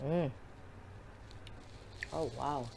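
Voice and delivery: sipping from a spoon; humming while tasting soup; mouth full of soup. Real, not acted. Seen 3: Sips green soup from spoon between and during the hums.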